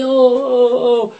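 slow whiny oh; mock whining. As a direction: whimper like a coddled child.

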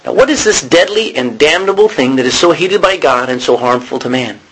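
ordinary talk, nothing marked

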